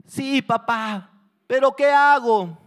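high, loud pleading cry; desperate, drawn-out yell; frightened child shouting. Acted. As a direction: call back to your father for help. Trapped child answers father by shouting.

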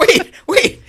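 very high, loud double take; high-pitched, baffled halt; startled interruption. Real, not acted. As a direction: cut in with squeaky disbelief. Stops story in disbelief.

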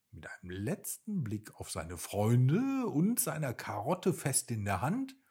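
plain reading voice

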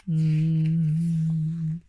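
long, level humming "mm"; thoughtful hum. A narrator's reading. A humming top thinking to herself, drawn-out hum. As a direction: hum to yourself, lips closed. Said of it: "hummed to herself as she thought".

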